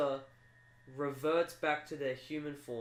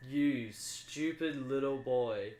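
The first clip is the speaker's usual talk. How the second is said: villain sneer; much slower than usual. Acted. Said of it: "Mister Forthcoming goes".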